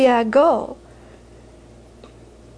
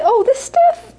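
much higher, mock-surprised mother; mother's surprised scoff; 12 semitones higher, 4 dB louder, slower than usual. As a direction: toss it off as a trifle, high. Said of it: "And she'd say".

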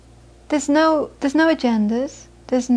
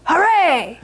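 high, drawn-out mock hooray; imitated fans' cheer; acted cheer; 5 semitones higher than usual. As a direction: cheer along with the crowd. Students cheering at a goal.

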